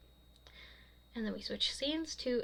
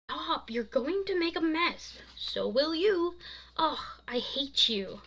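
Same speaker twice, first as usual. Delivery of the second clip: playful bickering; 7 semitones higher, 5 dB louder than usual. A narrator's reading. Playful struggle over ice cream.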